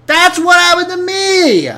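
excited outburst. Real, not acted. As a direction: blurt it out high in vindication. Friend sees same glitch.

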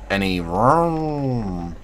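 slow drawn-out engine vroom; chainsaw impression. Shown as a demonstration. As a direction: stretch it like a revving chainsaw. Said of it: "that was my chainsaw impression".

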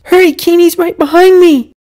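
put-on very high, loud child's voice; urgent yell. A narrator's reading. Boy urges his brother on with the wolf behind, urgent high yell.

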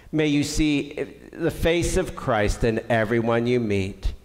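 ordinary talk

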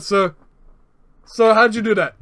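ordinary talk, nothing marked

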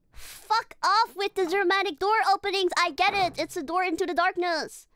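loud, high fed-up rant; exasperated yell. Real, not acted. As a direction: shout the game down in exasperation. Exasperated yelling at the game again.

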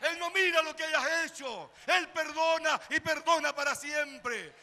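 loud, high preaching shout, slowing on the key words; climactic preaching. Acted. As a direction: drive the message home to the church. Clímax de la predicación. (predicación a gritos, alta y enfática)

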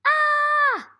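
high, loud mock scream "Ah"; scared ant shout. Shown as a demonstration. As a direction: yell "Ah" like a frightened ant, hands in the air. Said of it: "this ant says".

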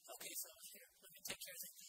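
hushed reassurance in the storm; calm reassuring Jesus. Retold or imitated. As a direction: soothe the frightened men gently. Jesus voiced calming disciples.

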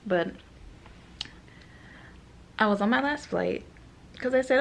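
normal talking voice

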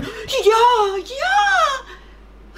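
loud, high drawn-out rabbit cry. Acted. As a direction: call out in a squeaky wail. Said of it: "rief das Kaninchen". (hoch und laut rufend)

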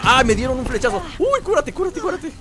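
high-pitched, panicky urging; urgent panic. Real, not acted. Player just hit by an arrow, urgent panic. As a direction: fret at yourself in a high voice.

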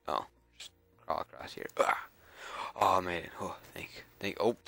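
normal talking voice

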